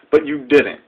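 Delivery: usual speaking voice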